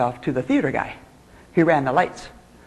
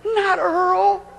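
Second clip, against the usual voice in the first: dismayed groan; 11 semitones higher than usual. Retold or imitated. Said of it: "And I went".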